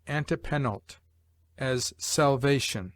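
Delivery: ordinary talk, nothing marked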